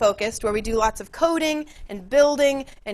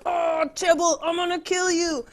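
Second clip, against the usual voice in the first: mock angry Romeo; 6 semitones higher than usual. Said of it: "he's like".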